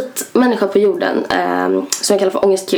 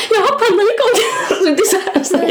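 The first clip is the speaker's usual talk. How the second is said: high-pitched hammed-up sob, close to laughing; mock crying; 12 semitones higher than usual. Imitating his livestreamed panic attack for friends, mock crying. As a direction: squeal it as a fake tearful wail. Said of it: "och gråter och bara".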